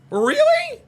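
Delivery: high loud incredulous cry; shocked disbelief. Real, not acted. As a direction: squeal in disbelief. Shocked at screen.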